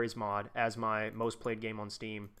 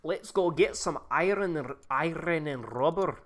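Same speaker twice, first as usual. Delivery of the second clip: slow, rough Scottish accent; a clumsy Scottish accent; 3 semitones higher, 4 dB louder, slower than usual. Shown as a demonstration. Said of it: "my Scottish accent is better than that usually".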